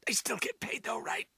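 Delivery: unvoiced conspiratorial whisper. Beaten fighter muttering an aside, whispered. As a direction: lean in and whisper it. Aside after losing a round.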